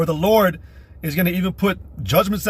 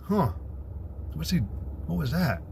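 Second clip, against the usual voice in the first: meek puzzled murmur; 10 dB quieter, slower than usual. Retold or imitated. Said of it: "shut the fuck up and be like".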